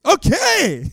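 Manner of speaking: a sunny, friendly okay, all good; cheerful okay. Retold or imitated. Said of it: "But they were actually like".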